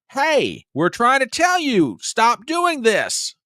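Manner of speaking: high, loud cry of the citizens to the government; pleading shout. Pretend. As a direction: yell it up high as the fed-up public, slowing at the end.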